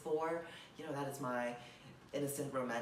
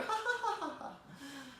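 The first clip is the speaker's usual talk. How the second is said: high-pitched theatrical cackle; stagey high laughter; hammed-up laugh; 13 semitones higher than usual. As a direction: peal out a high mock-triumphant laugh. Forced laugh after "there will be laughter.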